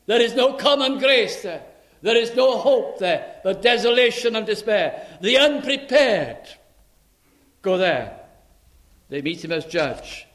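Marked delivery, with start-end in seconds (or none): 0.1-8.2 loud, high hellfire preaching; high, loud sermon climax; fiery preaching. Acted. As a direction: thunder it from the pulpit. Preaching at full force.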